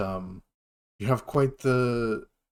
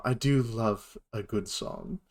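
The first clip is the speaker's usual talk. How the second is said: normal talking voice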